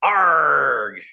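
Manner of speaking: hammed-up pirate growl; a mock pirate "arr". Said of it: "let's use pirate voice".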